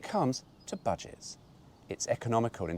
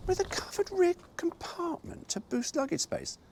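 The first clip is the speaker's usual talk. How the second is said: much higher, put-on salesman voice; mocking brochure voice. Mocking VW's brochure claim about luggage space, high put-on voice.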